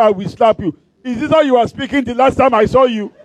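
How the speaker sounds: high, indignant teasing; mock scolding. Preacher to old classmate putting on grand talk, mock scolding.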